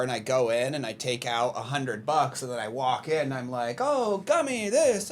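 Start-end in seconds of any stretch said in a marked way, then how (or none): 3.8-5.1 much higher mock delight at candy; lightly hammed-up shopping glee; excited. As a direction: squeal like a kid in a candy aisle.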